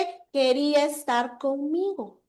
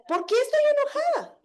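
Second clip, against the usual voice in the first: "¿Por qué estoy enojada?" asked again much higher, "estoy" lifted; second, higher take of the same self-question; 10 semitones higher than usual. Shown as a demonstration. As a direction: repeat it, pitch jumping up on "estoy". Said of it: "en realidad es eso".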